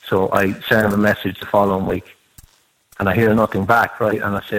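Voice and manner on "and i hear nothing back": normal talking voice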